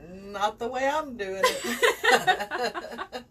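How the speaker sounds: laughing through the words, slow; drawn out between giggles. Real, not acted. Joking about her bad crochet.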